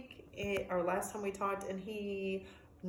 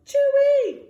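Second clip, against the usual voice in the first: alarmed bird call; 14 dB louder than usual. Acted. Bird flies up.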